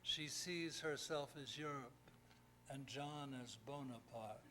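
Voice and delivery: measured ballad reading with pauses between lines; poem recitation. Acted. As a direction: recite an old-fashioned ballad, line by line.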